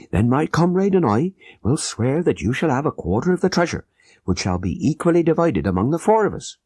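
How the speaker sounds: a hint of a tense, urgent plotter; Abdullah Khan. A narrator's reading.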